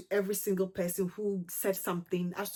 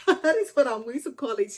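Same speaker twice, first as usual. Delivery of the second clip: laughing higher and louder through the words; 5 semitones higher, 6 dB louder than usual. Real, not acted. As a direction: laugh it off at her own name for it. Laughs at her own pronunciation.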